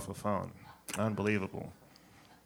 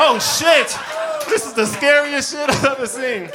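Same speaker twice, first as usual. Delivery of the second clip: high, loud onlooker outburst; shocked shout; 13 semitones higher, 9 dB louder than usual. Retold or imitated. Onlooker reacting to the comeback, shouting in shock. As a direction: shout it in amazed disbelief.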